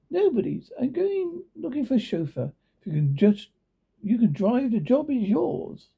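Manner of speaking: rich lady. Acted. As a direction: pitch up as the cool rich lady making an offer. Rich lady voiced by narrator.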